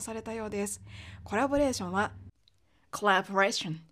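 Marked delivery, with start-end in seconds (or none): 2.9-3.8 コラボレーション as English collaboration with a strong wide 'æ' in ラ; American pronunciation. Shown as a demonstration. Teacher models collaboration correctly, strong American A. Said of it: "と発音する音なんですけれども".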